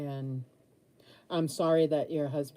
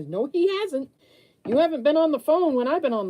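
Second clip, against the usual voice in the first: higher, louder and fast insistence; heated retort; 10 semitones higher, 7 dB louder than usual. Retold or imitated. Arguing with relatives about her ex, heated and fast. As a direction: push back hard, talking fast.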